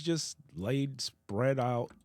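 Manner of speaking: ordinary delivery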